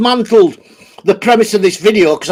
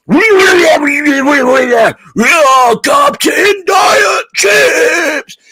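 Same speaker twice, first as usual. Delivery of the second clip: gargle it like a Tasmanian Devil caveman; Tasmanian Devil caveman mix; 5 semitones higher, much slower than usual. Retold or imitated. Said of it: "mix between Tasmanian Devil and caveman".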